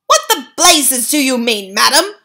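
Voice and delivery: loud, high, outraged shout; furious father shouting at the grandmother. A narrator's reading. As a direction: bellow it at her. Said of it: "shouted Mister Jenkins".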